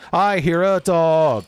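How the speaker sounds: high, loud, drawn-out warning; alarmed call. Real, not acted. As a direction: call out each word slowly and loudly. Repeats the dog warning louder.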